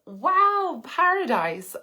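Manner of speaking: high, loud burst of delight on Wow. Real, not acted. Greeting a viewer from Mauritius with excited delight. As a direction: gush over a dream destination.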